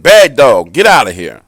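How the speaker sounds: loud scolding of a dog; scolding shout. Retold or imitated. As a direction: yell it like shooing a dog. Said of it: "as he continued to talk".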